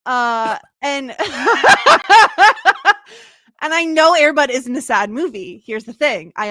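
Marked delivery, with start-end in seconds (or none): 1.2-3.2 high, loud burst of laughter. Real, not acted. Speaker admits crying at Air Bud, loud laugh. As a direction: burst out laughing at your own confession.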